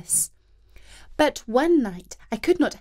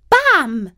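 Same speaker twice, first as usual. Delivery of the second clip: loud bam; 6 semitones higher, 8 dB louder than usual. A narrator's reading. Narrator's impact noise.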